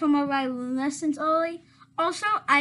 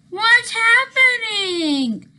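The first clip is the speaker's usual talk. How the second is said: slow, loud, higher panicked cry; panicked shout; 8 semitones higher, 8 dB louder, much slower than usual. Acted. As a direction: wail it out in alarm. Sudden chaos in the car.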